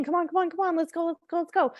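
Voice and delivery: high, hurried urging to hurry out the door; rushing a child. Retold or imitated. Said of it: "maybe because I was saying".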